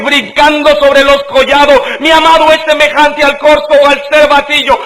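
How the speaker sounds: high, loud, elated cry of the bride; the bride hearing her beloved, exclaiming; joyful exclamation. Acted. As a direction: exclaim it with overflowing joy. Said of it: "la dice en signos de exclamación".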